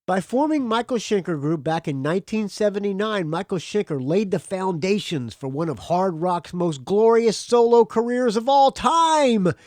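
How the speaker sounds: emphatic announcer reading with soaring peaks; grandly declaimed album promo. Acted. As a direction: read the press blurb as big rock hype. Reads album promo aloud.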